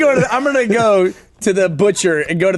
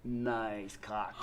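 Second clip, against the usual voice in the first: low, soft, confidential drop; imitated low, quiet come-on; leaning-over aside; 10 semitones lower, 16 dB quieter, much slower than usual. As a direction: drop it low and quiet, like a sly compliment at the urinal. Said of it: "he'd lean over ... he'd go".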